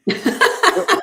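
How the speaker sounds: a short burst of real laughter; bright laugh. Real, not acted. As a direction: laugh it off, a little embarrassed. Laughs after her French line.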